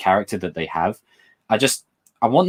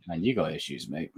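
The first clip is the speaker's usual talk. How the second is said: offhand quip; 7 semitones lower, 12 dB quieter than usual. Retold or imitated. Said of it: "He's just like".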